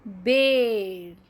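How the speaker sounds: बेल drawn out with a long e; slow model reading. Shown as a demonstration. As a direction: answer बेल slowly and clearly. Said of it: "क्या बन जाता है".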